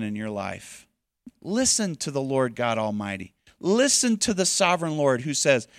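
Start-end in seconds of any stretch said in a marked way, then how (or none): none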